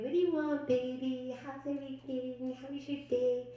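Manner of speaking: tender home voice. Retold or imitated. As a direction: sweetly greet your partner. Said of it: "when I'm home, I'm, like, easy going".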